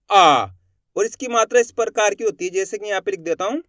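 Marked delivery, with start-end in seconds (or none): 0.1-0.5 आ held long and loud; drawn-out aa. Shown as a demonstration. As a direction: stretch आ out with force. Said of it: "इसको अपन खींच के पढ़ेंगे". (खींचकर बोला गया लंबा आ)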